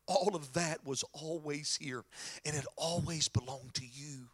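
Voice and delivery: low, soft, tender answer; hushed fatherly reassurance; reassuring father. Acted. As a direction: gently soothe an angry son, pitched well down. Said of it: "his dad says to him".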